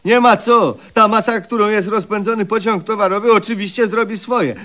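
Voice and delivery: high, loud, rushed theatrical complaint; mock outrage. [name] to Eliza, high and loud mock outrage. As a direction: protest like a wounded prima donna. Outraged at being sent to death.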